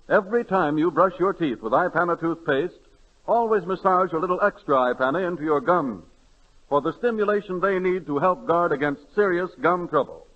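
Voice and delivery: normal talking voice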